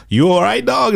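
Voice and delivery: high-pitched imitation of friend; high mock voice. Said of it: "He was like".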